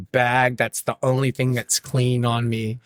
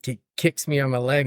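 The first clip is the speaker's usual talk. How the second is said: normal talking voice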